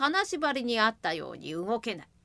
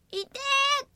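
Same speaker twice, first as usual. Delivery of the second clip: high sharp yelp; yelp of pain. Acted. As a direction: cry out at the sudden pain. Imp hits his hip. (高い声で痛がる)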